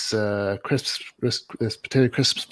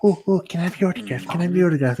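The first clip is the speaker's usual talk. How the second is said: higher, gushing eager fan; over the top fan; 8 semitones higher, 5 dB louder, faster than usual. Retold or imitated. Retelling a friend playing a crazed fan, mildly gushing. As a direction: beg for the autograph like a giddy fan. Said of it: "act like a very over the top fan".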